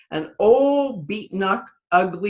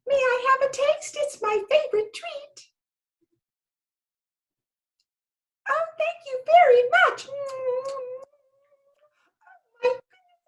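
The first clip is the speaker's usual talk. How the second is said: very high, tiny sweet fairy voice; teeny fairy voice. Acted. Fairy asking the doll for sweet potatoes, tiny high voice. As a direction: squeak it out like a polite little fairy. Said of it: "a little teeny voice say".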